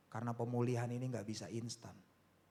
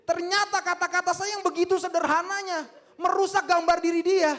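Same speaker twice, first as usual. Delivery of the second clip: full-force preaching; 18 dB louder than usual. Real, not acted. Preacher telling congregation healing is a process, forceful preaching. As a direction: drive the point home at full volume.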